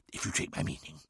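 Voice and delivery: breathy knowing whisper; conspiratorial whisper. Acted. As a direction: whisper it like a secret deal. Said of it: "whispered conspiratorially".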